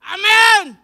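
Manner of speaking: very loud, high shouted Amen. Real, not acted. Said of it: "ilakas mo nga".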